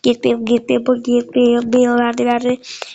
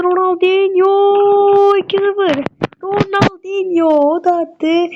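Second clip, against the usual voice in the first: high, slow disbelieving call; exasperated commentator; 8 semitones higher, slower than usual. Real, not acted. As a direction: cry out at the goal. Opponent scores.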